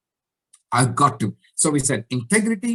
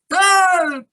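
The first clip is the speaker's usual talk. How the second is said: loud, high cry for help; panicked shout; 12 semitones higher, 7 dB louder than usual. Acted. Man in chimpanzee suit facing a lion, shouting for help. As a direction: shout it out at full pitch. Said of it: "He shouts out".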